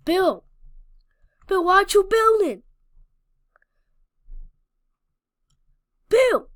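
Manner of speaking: loud high fast yell. Real, not acted. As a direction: call out loudly. Yelling at game villager.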